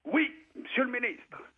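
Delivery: high, clipped soldier's reply to a superior; soldier answering. Retold or imitated. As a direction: bark it up high like a para at attention. Para answers minister.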